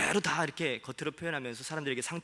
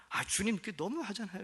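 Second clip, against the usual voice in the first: higher, complaining plea; whiny protest; 7 semitones higher than usual. Retold or imitated. Pastor voicing his own protest to the Lord, whiny and higher. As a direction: protest like a sulking child. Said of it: "이렇게".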